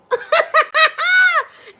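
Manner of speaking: loud high laughing burst; shrieking laugh. Real, not acted. Laughing loudly at her own joke to viewers.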